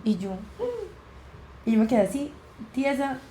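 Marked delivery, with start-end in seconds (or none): 0.3-1.7 tiny high stunned hum, barely audible; a small choked sound of shock; stunned whimper. Retold or imitated. Hearing aunt died, frozen. (gemido agudo y ahogado de la sobrina al enterarse)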